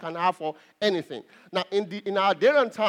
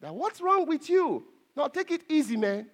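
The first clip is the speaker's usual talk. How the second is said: alarmed onlooker; 7 semitones higher than usual. Retold or imitated. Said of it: "everyone just freaks out".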